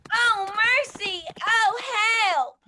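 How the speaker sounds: slow, loud, alarmed calls as she falls; panicked plea for mercy and help; frightened cry for help. Acted. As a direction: cry out in fright while tumbling. Thrown from horse down slope.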